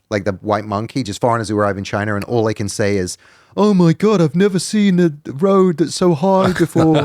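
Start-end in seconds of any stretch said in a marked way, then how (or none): 3.5-7.1 high, gushing put-on English yokel voice; hammed-up naive Brit abroad; mock naive foreigner accent. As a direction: gush like a wide-eyed naive tourist. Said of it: "I'm putting on that accent".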